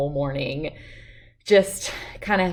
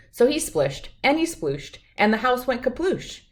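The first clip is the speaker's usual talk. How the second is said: ordinary delivery, nothing marked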